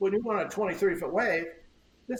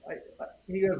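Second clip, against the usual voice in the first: ordinary talk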